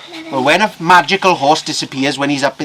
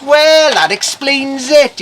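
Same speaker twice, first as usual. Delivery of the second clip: high, loud, drawn-out realisation; triumphant reveal; 11 semitones higher, 9 dB louder, slower than usual. Acted. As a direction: launch the big explanation with relish. Blacksmith's big reveal.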